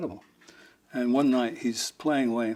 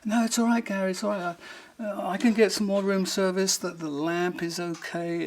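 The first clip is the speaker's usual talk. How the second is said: high, raised-voice consoling; retold frantic reassurance; 7 semitones higher than usual. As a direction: call after a fleeing friend to calm him. Frantically reassuring the fleeing drummer.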